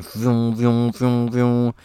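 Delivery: vroom noises, building slightly each time; vocal revving sound effect for the powers coming in; engine vroom noise. Shown as a demonstration. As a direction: rev the engine with your mouth. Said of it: "figure out if I got any noises".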